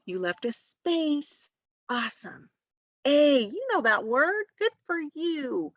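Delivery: put-on high-pitched, hushed delight for a child; gasping, encouraging teacher praise; recognizing good work. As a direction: act amazed at each little thing they got right. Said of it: "recognizing their good work".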